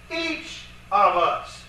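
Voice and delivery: slow, with "Each" drawn out; emphatic each. Shown as a demonstration. Lecturer showing how the Greek emphasizes it, slow stress on "Each". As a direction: stretch out "Each of us" word by word. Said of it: "you want to emphasize it".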